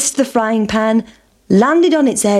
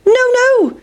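very high, sing-out refusal; defiant pancake; 4 dB louder than usual. Acted. Pancake to hungry boys, defiant.

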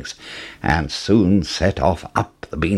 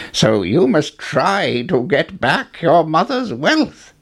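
put-on old man voice; 7 semitones higher than usual.

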